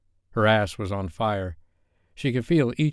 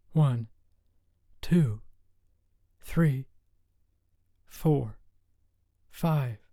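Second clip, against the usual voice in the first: desperate counting; 5 semitones higher, much slower than usual. A narrator's reading. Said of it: "she counted in her head in desperation".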